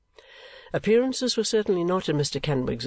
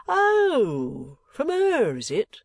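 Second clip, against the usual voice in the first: high, surprised exclamation; 11 semitones higher, 4 dB louder, much slower than usual. A narrator's reading. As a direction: pitch the oh up in surprise. Kenwigs surprised at the name.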